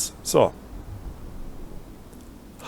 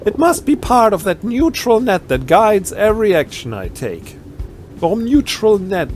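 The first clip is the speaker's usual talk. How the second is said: ordinary talk, nothing marked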